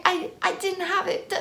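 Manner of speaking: high, stammered excuse; mocked roommate. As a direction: mimic her in a high-pitched voice. Imitating the roommate's flustered excuse.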